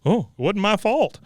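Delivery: high, loud, relieved mock husband voice; host voicing Hank shrugging off blame, relieved; imitating a husband. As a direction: chirp it out like a guilty man off the hook. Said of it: "He goes".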